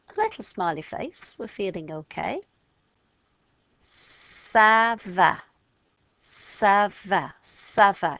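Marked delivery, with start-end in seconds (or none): 3.8-8.2 "Ça va" said brightly in French, sa va. Shown as a demonstration. Teacher modelling French greeting for class, bright and clear. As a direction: model "Ça va" clearly, a little louder. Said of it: "If you go ça va".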